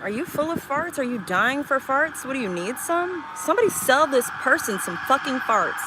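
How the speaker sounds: high, loud comic rant; mock exasperation. Real, not acted. Streamer badgered about farts by a chatter, mock-exasperated. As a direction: build to a loud, exasperated appeal.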